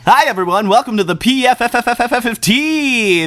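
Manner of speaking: high, bright mock radio DJ; hammed-up radio announcer welcome; radio host voice. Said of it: "play pretend professional radio".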